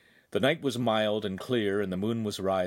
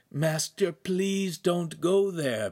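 high, slow pleading caution; warning; 8 semitones higher, much slower than usual. A narrator's reading. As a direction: warn the master off, drawing the words out. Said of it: "Pan warned".

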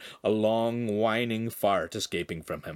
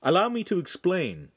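higher, projected declaration; repeating aloud; 8 semitones higher, 3 dB louder than usual. A narrator's reading. Handsome repeats Pan's words to the master, projected. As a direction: speak up with the borrowed line.

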